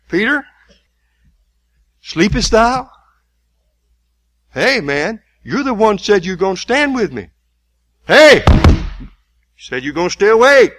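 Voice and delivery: loud, high, exasperated calling out to Peter; Jesus voiced as an angry friend shouting at sleeping Peter; scolding shout. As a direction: yell at a dozing friend to wake up. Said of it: "Jesus didn't say it in that tone of voice".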